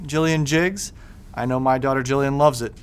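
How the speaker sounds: plain reading voice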